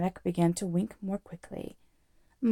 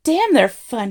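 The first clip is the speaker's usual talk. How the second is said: loud, raised-pitch angry hoot; furious hoot; 4 semitones higher, 10 dB louder, slower than usual. A narrator's reading. Arthur running furiously out of the pub, hooting. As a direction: hoot it out in fury. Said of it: "he hooted".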